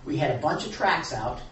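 ordinary talk

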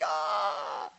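drawn-out mock shriek; hammed-up demonic scream; imitated demon shriek. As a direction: cry out as a departing spirit. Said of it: "he did with a shriek".

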